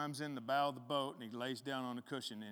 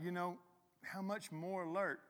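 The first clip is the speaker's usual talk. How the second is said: normal talking voice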